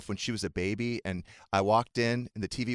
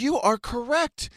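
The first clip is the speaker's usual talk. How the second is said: slow proud father; 6 dB louder, slower than usual. Retold or imitated. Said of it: "I said".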